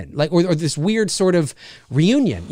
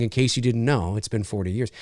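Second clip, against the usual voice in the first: dropped low and soft, a wry aside; mock aside to camera; 7 semitones lower, 5 dB quieter than usual. Reviewer mocking actors winking at the camera, soft and wry. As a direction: hush it like telling the audience a secret. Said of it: "turning to the camera and saying".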